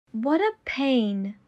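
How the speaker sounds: ordinary delivery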